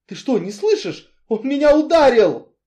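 loud high shout, rising to a scream; furious shout. A narrator's reading. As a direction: shout at him in outrage. Woman shouting, hit.

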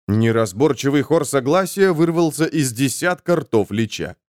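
ordinary delivery

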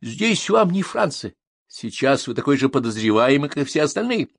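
high-pitched irritated rebuke; angry. A narrator's reading. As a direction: put him in his place. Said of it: "разозлился комиссар". (сердито, раздражённо)

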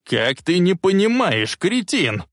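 put-on old man outraged at his grandson; indignant. A narrator's reading. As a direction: fume at the idiot. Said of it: "возмущённо сказал он".

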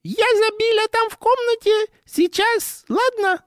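very high falsetto fake lady; squeaky voice. Keeps up the lady voice.

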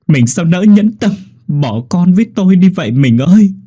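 high, loud wailing grief; wailing sob. Acted. Husband at his wife's grave, wailing in grief. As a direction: howl your grief at the grave. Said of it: "rồi khóc".

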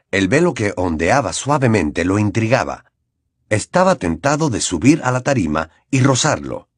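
usual speaking voice

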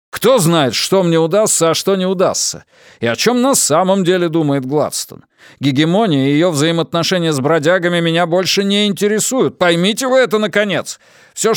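high, loud furious outburst. A narrator's reading. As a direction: build to a furious curse. Consul erupts, rejects both sides.

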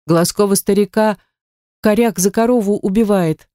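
ordinary delivery, nothing marked

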